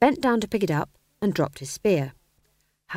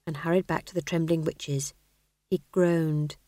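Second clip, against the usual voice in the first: usual speaking voice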